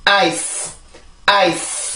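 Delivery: final S in 아이스 pushed hard and drawn out long; strong final S. Shown as a demonstration. Teacher showing students the mistake, 아이스 with over-strong long S. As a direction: let the S of 아이스 hiss on too long. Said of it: "만약에 세게 발음이 된다면".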